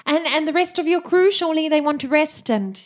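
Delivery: high, loud questioning call; boatwoman's voice. Acted. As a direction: call up in a put-on woman's pitch. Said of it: "she's like".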